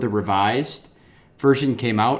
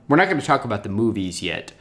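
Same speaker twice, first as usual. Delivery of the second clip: ordinary talk, nothing marked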